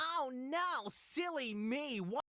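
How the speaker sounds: put-on high, breathy, overeager kid voice; high eager child voice. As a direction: play the kid begging for punishment. Voicing the child on the game.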